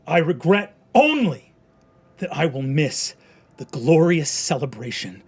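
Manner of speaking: loud, high, defiant last words; defiant speech. Acted. The condemned man defies the crowd, loud and proud. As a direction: hurl defiance at the crowd from the gallows. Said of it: "He looks out at you and goes".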